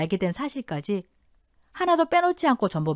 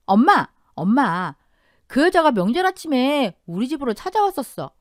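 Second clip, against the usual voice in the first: louder, higher-pitched eager news; excited daughter; 4 semitones higher, 4 dB louder than usual. Acted. Daughter telling mom that woman showed up, excited. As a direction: burst out with juicy news.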